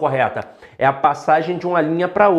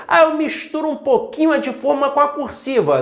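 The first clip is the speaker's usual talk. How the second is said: mock student worry; 8 semitones higher than usual. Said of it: "imagined student worry".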